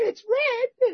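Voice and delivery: lightly voiced Kathy, pitched high; imitating Kathy. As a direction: squeak out her defensive reply. Quoting Kathy's curt reply.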